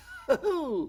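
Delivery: high burst of real laughter. Real, not acted. Magician laughing at his own botched trick, real laughter. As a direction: crack up at the failed trick.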